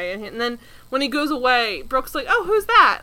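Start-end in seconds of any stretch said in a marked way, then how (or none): 2.3-3.0 high, louder, perky imitation of friendliness; very friendly. Podcaster voicing Brooke asking after the concierge, very friendly. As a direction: ask with hammed-up friendly curiosity. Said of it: "Like, very friendly".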